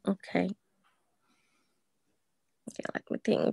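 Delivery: soft unvoiced whisper; whispering to self. Real, not acted. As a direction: whisper it while thinking. Thinking aloud before roleplay.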